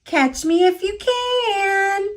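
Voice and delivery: high, slow teasing call; mild mock shout of a little cookie; gingerbread baby shouting. A narrator's reading. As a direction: call back from far off, high and taunting. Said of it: "the gingerbread baby shouting".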